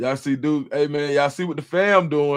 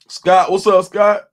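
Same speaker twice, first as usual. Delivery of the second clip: louder, bright call to a viewer; loud greeting; 2 semitones higher, 9 dB louder, slower than usual. Real, not acted. Streamer greeting a viewer in chat, loud happy callout. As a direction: call out to him happily.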